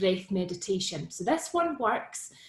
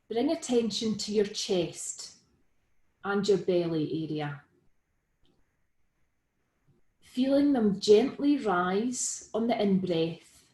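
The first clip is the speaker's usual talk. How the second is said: guided meditation. Real, not acted. Guiding listeners into Be With The Breath, calm meditation delivery. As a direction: lead them gently through it, leaving room to breathe.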